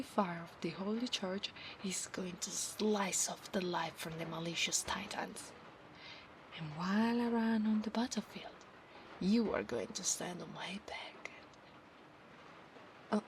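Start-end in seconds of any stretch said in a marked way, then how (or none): 1.8-11.3 soft whispered storytelling; hushed whisper. Acted. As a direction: whisper the promise close to his ear. Whispered roleplay.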